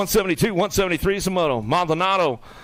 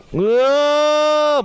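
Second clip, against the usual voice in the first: long, higher and louder whoa; excited whoa; 10 semitones higher, 6 dB louder than usual. Real, not acted. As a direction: let the crowd feel the surge. Rider surges, announcer reacts.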